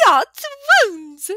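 high, loud cackling exclamation; old innkeeper widow whooping with delight at Bear; laughing cry. A narrator's reading. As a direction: blurt it out crowing with glee. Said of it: "she cried with lip-synced, spittle-splaying laughter".

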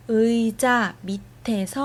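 ordinary talk

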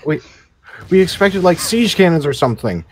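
high, loud mock complaint; excited. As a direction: squeal it in fake outrage. Mocking enemy expectation.

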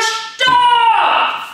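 very high, full-force sung-out STOPP; loudest stop shout. Shown as a demonstration. Coach at the final, loudest stop round. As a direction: give it everything. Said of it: "noch einmal lauter".

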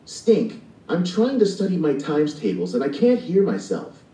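lightly acted sisterly annoyance; annoyed sister. A narrator's reading. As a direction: complain at a noisy brother. Annoyed sister complaining about noise.

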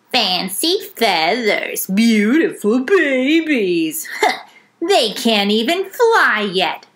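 slow, mocking crow voice; cawing crow voice. A narrator's reading. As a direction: drag it out like a sneering crow. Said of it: "cawed Crow".

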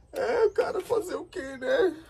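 high, loud, laughing through fake tears; mock crying. Boy consoling himself over a mug, laugh-crying. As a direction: sob and chuckle at once, resigned. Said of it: "Você tá chorando".

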